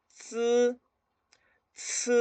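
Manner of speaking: "ts" high and level, first without air, then with a puff; spaced drill syllables "ts, ts", tongue at the teeth; TS sound. Shown as a demonstration. Teacher repeating for learners, model "ts". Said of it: "Ahora, T S".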